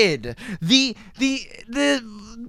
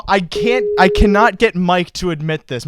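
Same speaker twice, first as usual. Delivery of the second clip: ordinary talk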